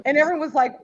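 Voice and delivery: usual speaking voice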